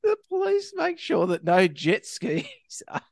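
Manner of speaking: high, squeezed voice losing it to laughter; real giggles breaking up the words; cracking up. Real, not acted. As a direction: try to get it out while laughing. Cracks up at jet ski memorial.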